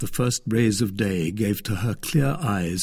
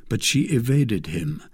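ordinary delivery

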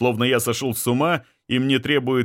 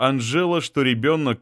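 plain reading voice

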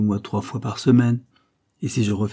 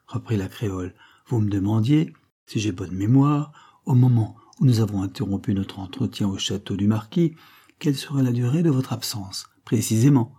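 plain reading voice

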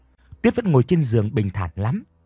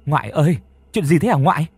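flustered asking; 4 semitones higher, 3 dB louder than usual. A narrator's reading. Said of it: "rối rít hỏi".

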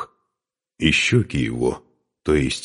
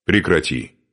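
ordinary delivery

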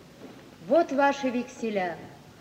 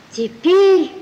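much louder and higher single word; emphatic declaration; 6 semitones higher, 11 dB louder than usual. Acted. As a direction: slam down the word Теперь. Wife turns on husband decisively. (громко, решительно)

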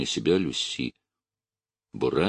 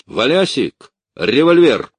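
high, very loud command; shout; 9 semitones higher, 10 dB louder than usual. A narrator's reading. Officer calls his orderly for a revolver, shouting. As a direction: bellow for the orderly. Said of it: "крикнул Бураев".

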